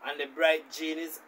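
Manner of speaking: ordinary delivery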